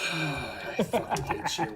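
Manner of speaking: slow sigh breaking into laughter; mock-hateful grumble through a laugh; laughing exasperation. Real, not acted. Game master reacting, sighing with laughter.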